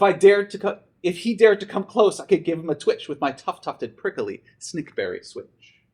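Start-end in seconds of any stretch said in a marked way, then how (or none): none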